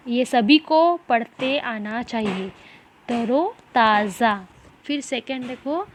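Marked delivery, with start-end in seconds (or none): 3.1-4.5 तरोताज़ा slow and drawn out; model pronunciation. Shown as a demonstration. Teacher has pupils repeat after her, slow model pronunciation. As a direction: say तरोताज़ा slowly, part by part: तरो, ताज़ा. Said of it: "जैसे मैं बोलती जाऊँगी उसी तरह से".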